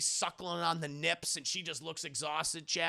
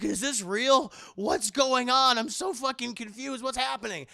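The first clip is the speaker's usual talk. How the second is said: higher and louder, bewildered; loud confused panic; 6 semitones higher, 6 dB louder than usual. Retold or imitated. Padre entering the scene, loud confused panic. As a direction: sound lost and alarmed. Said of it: "he's like".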